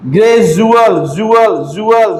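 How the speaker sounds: ग्रेजुअल loud, जुअल with ज; loud teaching Gradual. Shown as a demonstration. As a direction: stress the ज in जुअल. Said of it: "डी का उच्चारण क्या होगा?". (जुअल को ज की आवाज़ से जोर से)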